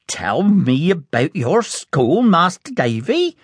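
Peggotty légèrement jouée, plus haute et tendre; fond nanny voice. A narrator's reading. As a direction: une nounou aimante, voix un peu plus aiguë. Peggotty's voice again.

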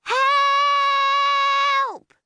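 high, long desperate call; shouted for help. A narrator's reading. Lost girl shouts for help in the woods. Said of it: "she shouted".

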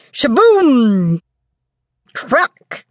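punchy thunder crash, voiced by the storyteller; thunder sounds. A narrator's reading. Narrator imitating thunder, a lightly acted crash. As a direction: spit out the thunderclaps.

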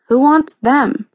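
groaning complaint. A narrator's reading. Boy scorning the fairy tale comics, groaning. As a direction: drag it out as a mild groan. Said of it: "Kurt groaned".

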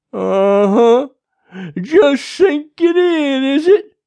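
loud, high, drawn-out jeer; overbearing sheriff, taunting. A narrator's reading. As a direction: rub it in slowly and loudly.

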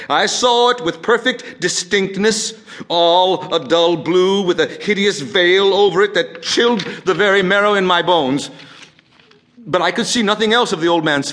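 high, loud and agitated madman; rising outrage with hard-hit key words; mounting fury. Acted. Madman describing the hideous vulture eye, furious and agitated.

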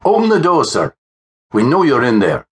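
high-pitched police demand, falling away at the end; commanding constable. A narrator's reading. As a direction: call through a closed door with authority. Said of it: "It was the voice of Constable Ferguson".

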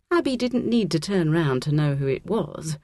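usual speaking voice

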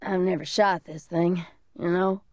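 ordinary delivery

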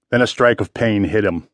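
ordinary delivery, nothing marked

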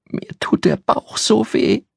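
put-on high, slow, breathy whimper of a hurting girl; a sick little girl barely able to speak; a child's pained whisper. A narrator's reading.

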